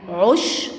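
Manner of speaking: عشب split as "'ush", a pause, then "bun"; sukun shown. Shown as a demonstration. Teacher shows that sukun leans on the letter before, split "'ush - bun". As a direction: close عشب on the sh, stop, then add "bun". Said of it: "والسكون ما بتتنطقش لوحدها".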